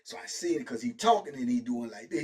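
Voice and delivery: ordinary talk, nothing marked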